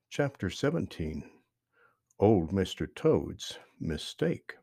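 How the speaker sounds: plain reading voice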